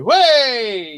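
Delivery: high, loud, drawn-out "way!"; guards' cheer. Acted. Other guards back up their captain, cheering loudly. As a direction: cheer like a crowd of revelers. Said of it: "you hear the other guards go".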